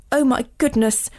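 a touch of storybook dismay; understated wail. A narrator's reading. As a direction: lament it lightly, without much volume. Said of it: "wailed Miss Cherry".

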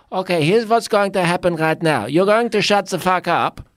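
high, loud, blunt therapist laying down the law; Esther Perel impression. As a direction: impersonate the no-nonsense therapist cutting a client off. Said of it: "the first words I ever heard Esther Perel say were".

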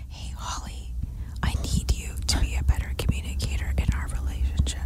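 unvoiced whisper, fast; right in your ear. Shown as a demonstration. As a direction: whisper it close into her ear. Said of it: "whispering into your ear".

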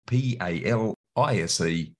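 slow, letter-by-letter spelling; careful spelling of a web address; spelled out slowly. Shown as a demonstration. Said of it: "That's Palisi".

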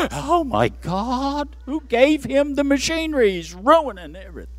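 mock horror. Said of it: "All the technological people would go".

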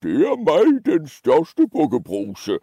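bold put-on goat voice, opening high; biggest billy goat. Biggest goat answering the troll, bold put-on voice. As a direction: announce yourself grandly to the monster.

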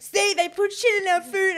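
high, loud mock rant; conspiracy ranter. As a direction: imitate a man worked up over a conspiracy.

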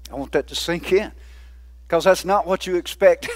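usual speaking voice

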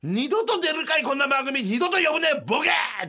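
high, loud furious shout; explosive rage. Retold or imitated. Partner snapping at the producer in the hallway, furious shout. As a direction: blow up at the producer. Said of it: "中島が勝手に".

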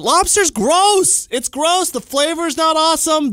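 disgusted rant. Real, not acted. Ranting disgust about lobster.

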